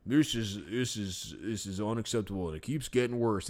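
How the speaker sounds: low, soft stuffy announcer voice; lightly voiced disapproving old announcer; mock announcer. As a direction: grumble it like a prim old announcer. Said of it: "it's like".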